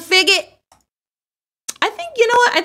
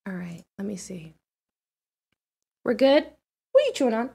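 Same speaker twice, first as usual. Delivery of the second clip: usual speaking voice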